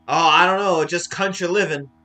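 loud, hammed-up southern country voice; southern accent. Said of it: "he has this southern accent".

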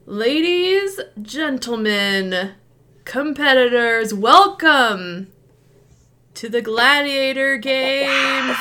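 booming ringmaster welcome, high and slow; grand announcer. Acted. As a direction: open the games like a showman. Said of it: "he's just like".

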